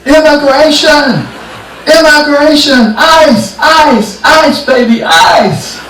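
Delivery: very high, loud alarm shout; yelling. Retold or imitated. Paul yells at the fishing crowd to scatter them, high yell. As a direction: holler a warning over a crowd. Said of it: "And they yell".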